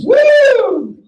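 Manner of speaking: high whooping cheer; excited whoop. Real, not acted. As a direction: cheer the crowd up. Hyping the big prize.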